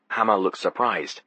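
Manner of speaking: ordinary delivery, nothing marked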